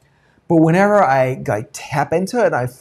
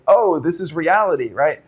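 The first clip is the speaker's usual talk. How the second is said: ordinary talk, nothing marked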